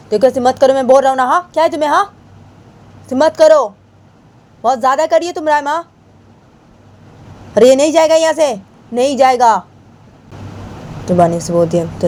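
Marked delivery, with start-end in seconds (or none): 0.1-5.7 high-pitched, heated telling-off; acted exasperated outburst; angry scolding. Acted quarrel, scolding Rama. 7.6-9.6 high, forceful acted refusal; heated, insistent objection; angry refusal. Acted quarrel, refusal repeated angrily.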